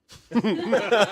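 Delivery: short high nervous giggle; nervous laugh. Real, not acted. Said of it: "that's a nervous laugh as well".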